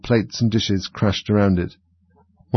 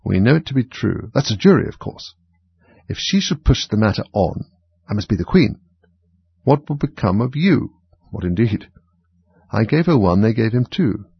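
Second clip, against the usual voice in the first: plain reading voice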